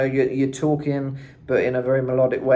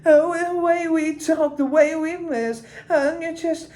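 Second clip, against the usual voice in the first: high, slow, British croon; sloppy emotional British singer voice. Shown as a demonstration. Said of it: "British, is the main thing".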